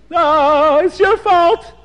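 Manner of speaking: high, loud tearful blame; crying accusation. Acted. Crying beggar blames the rich father, high tearful wail. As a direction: wail the blame through tears.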